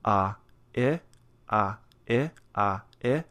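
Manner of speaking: "ah", then short "ih" rising, in pieces; separated diphthong parts. Shown as a demonstration. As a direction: alternate "ah" and a short lax "I" as in sit. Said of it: "when we combine ah with I".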